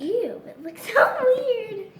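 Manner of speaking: slow, high laughing ew; laughing disgust. Real, not acted. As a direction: giggle in disgust. Disgust at batter.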